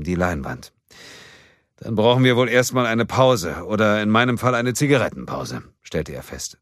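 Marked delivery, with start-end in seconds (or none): 1.8-5.6 team boss announcing. A narrator's reading.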